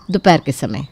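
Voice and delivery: plain reading voice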